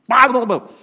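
sudden pretend eruption of tongues; mock involuntary outburst. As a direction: blurt out gibberish as if taken over. Mimics uncontrolled burst of tongues.

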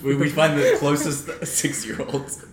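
slow, laugh-filled words; laughing while speaking. Real, not acted. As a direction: say it while cracking up at the idea. Laughing at own joke.

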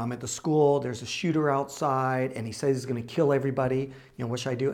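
ordinary talk, nothing marked

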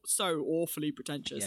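normal talking voice

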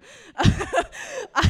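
loud, high burst of laughter; emotional laugh. Real, not acted. Speaker overcome before her friends, loud emotional laugh. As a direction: burst out laughing to fend off tears.

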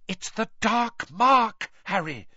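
high, drawn-out fearful moan. Acted. Hermione pulling Harry away, moaned. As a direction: moan it slowly in dread. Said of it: "Hermione moaned".

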